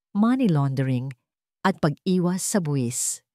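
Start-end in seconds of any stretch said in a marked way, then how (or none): none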